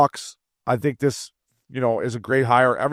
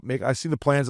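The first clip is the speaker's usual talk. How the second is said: usual speaking voice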